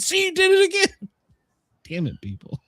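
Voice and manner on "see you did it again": very high-pitched mock accusation; retold gotcha. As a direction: pounce on it, imitating the family.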